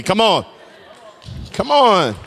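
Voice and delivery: high, loud prodding call. Real, not acted. Preacher to a congregation not talking to him, calling out.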